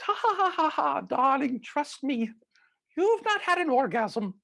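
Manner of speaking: hammed-up German accent of an old lady, laughing then reassuring; Doctor Ruth German accent. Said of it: "in this high-pitch accented German".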